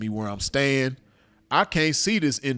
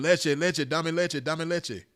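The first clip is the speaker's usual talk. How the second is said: higher, quick mock pleading yell; retold scream; 5 semitones higher than usual. Maced man demanding milk in Spanish, retold scream. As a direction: imitate a desperate cry, kept at talking volume. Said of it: "I'm screaming".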